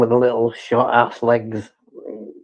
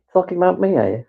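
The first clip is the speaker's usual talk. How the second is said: voicing the dog; 7 semitones higher, faster than usual. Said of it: "he's looking up at me going like that".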